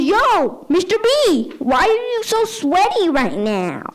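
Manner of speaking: put-on cheeky kid. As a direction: call out teasingly. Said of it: "this kid goes".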